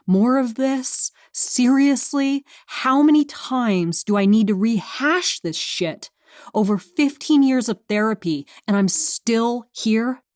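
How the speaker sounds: high-pitched, incredulous inner rant; exasperated disbelief. A narrator's reading. Patient silently railing at fifteen years of therapy, exasperated. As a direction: stretch the questions out, high and fed up.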